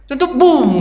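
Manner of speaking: loud, high mock boom of rain pouring down. Preacher mimics rain crashing down from a bucket, loud and high. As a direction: burst it out like a bucket of water dumped. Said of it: "kata dia kan".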